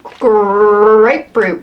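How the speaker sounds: "grapefruit" with a long, exaggerated start; drawn-out GRAPEFRUIT. Vlogger reading out a flavour that scares her, dragging out "grapefruit". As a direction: stretch the "gr" of "grapefruit" right out. Drags out a flavour she dreads.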